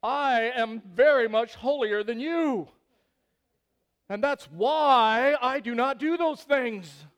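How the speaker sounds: loud, high, self-righteous proclamation; hammed-up sanctimonious boasting. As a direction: proclaim your holiness loudly, stretching the words. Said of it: "in those questions we're showing".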